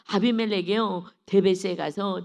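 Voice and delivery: loud, declaiming Bible reading; scripture reading. Acted. Preacher reads Judges aloud to the church, declaiming. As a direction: read the passage out to the congregation.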